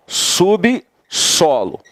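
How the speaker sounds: "Subsolo" bem devagar, sub-so-lo, com "i" leve: subisolo; silabando devagar. Shown as a demonstration. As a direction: separe as sílabas de "Subsolo" bem lentamente. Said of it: "divide subsolo aí". ("Subsolo" silabado devagar)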